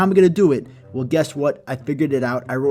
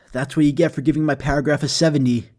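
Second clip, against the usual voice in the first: ordinary delivery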